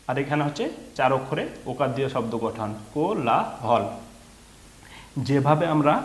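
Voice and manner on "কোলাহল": কোলাহল in three beats, কো-লা-হল; broken into syllables. Shown as a demonstration. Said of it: "ভেঙে ভেঙে এই শব্দগুলোকে".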